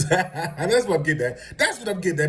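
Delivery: laughing through the words. Real, not acted. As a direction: say it while cracking up. Laughs while repeating point.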